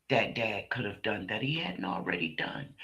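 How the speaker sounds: whispered aside. Real, not acted. Speaker stressing the father did everything, in a whisper. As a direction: breathe it out in a whisper. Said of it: "whispered line".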